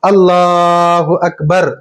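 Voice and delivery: slow, drawn-out takbir, evenly chanted; slow steady call of Allahu Akbar; prayer takbir. Shown as a demonstration. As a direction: intone it like the imam opening the prayer. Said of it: "नमाज ईद शुरू हो रही है".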